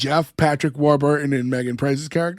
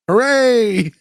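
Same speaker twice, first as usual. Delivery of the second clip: retold half-awkward hooray, raised in pitch; mock cheer after an awkward silence; 5 semitones higher, 4 dB louder than usual. Host retells a character answering engagement news, awkward cheer. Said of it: "In silence, he goes".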